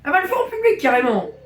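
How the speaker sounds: high, loud shocked exclamation; loud incredulous outburst; scandalised disbelief. Real, not acted. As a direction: exclaim it high, appalled at the screen. Shocked reaction to the video.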